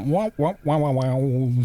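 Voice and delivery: vocal wah-wah of a cupped trumpet; mimicked brass tonal shift; muted trumpet imitation. Shown as a demonstration. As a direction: open and close the sound like a trumpet mute. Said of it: "a trumpet and they put a cup".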